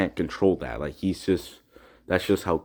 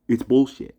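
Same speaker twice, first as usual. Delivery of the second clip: angry outburst; 4 semitones higher, 6 dB louder than usual. Real, not acted. Angry about teacher ignoring it.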